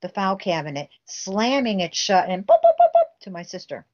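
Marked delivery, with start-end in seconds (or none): 2.5-3.2 high, loud boops like running heels; imitated heel clicks. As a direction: tap out the heels with your voice. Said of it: "clicking of her heels running".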